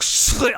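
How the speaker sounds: loud, high vocal scrape of a blade; mouth-made sword swish; sword unsheathing noise. Acted. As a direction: imitate a sword sliding out. Imitates a sword being drawn.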